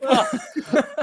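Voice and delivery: stunned huh. Retold or imitated. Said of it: "One of them things that you're like".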